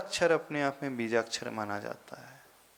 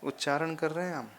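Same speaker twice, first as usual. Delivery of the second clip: ordinary delivery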